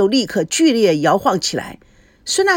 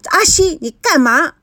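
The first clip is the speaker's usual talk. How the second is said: raised, indignant yell; angry shout; 6 semitones higher than usual. A narrator's reading. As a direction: call out in jealous anger. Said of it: "她愤怒大喊".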